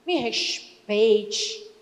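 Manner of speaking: slow, drawn-out offended wife; Me respeite, slow and haughty; stern wife. Acted. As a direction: stretch it out like a prim wife. Wife rebuffing husband's teasing.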